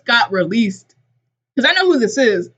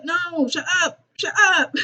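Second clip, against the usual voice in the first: exasperated scolding; 8 semitones higher than usual. Pretend. Host hushing Ellie as she calls Alex, comic exasperation. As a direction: beg for silence in mock exasperation.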